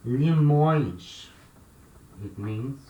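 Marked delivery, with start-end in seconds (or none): 0.1-1.3 limões with nasal õe and a final sh sound; Portuguese plural. Shown as a demonstration. Teacher modelling the plural limões. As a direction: say limões as "lee-MOINSH". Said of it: "The plural form of this word limão".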